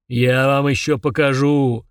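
slow, drawn-out goblin roar; roaring threat. A narrator's reading. Giant stuck goblin shouting after fleeing twins, slow roar. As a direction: bellow the threat slowly after them. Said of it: "ревел он вслед близнецам".

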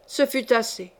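plain reading voice

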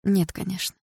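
understated dismissive reply; dismissive denial. Acted. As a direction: brush the question off without force. Boyfriend denies, after a grimace.

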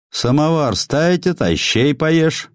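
put-on high, slow old woman's question; old peasant woman. A narrator's reading. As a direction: voice the old wife, high and drawn out. Old wife asks husband flatly.

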